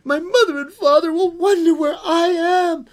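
put-on very high, loud child wail; distressed girl's cry. Girl tells creature of her parents, high wailing. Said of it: "cried the little girl".

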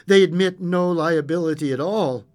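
formal. Acted. Visitor's formal message.

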